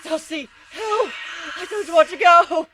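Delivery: high, loud panicked cry for help; terrified scream. Acted. Mare being dragged into the floor, screaming for help. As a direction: scream as you're pulled under.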